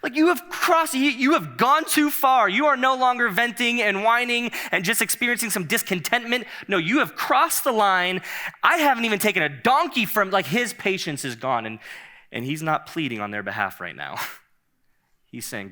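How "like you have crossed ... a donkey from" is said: high, loud, fed-up outburst; high-pitched exasperated cry; exasperated Moses. Acted. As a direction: voice Moses at the end of his rope. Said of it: "he is so".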